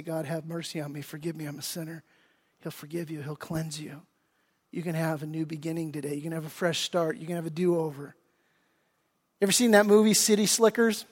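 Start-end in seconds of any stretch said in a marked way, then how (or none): 0.0-2.0 much softer, almost breathed; soft prayer. Shown as a demonstration. 2.6-8.1 much softer, gentle altar-call voice; soft invitation. Real, not acted. Intimate invitation, held soft.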